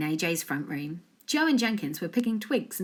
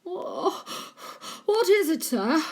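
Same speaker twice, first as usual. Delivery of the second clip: out of breath; 11 semitones higher, slower than usual. A narrator's reading. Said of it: "Jenkins puffed".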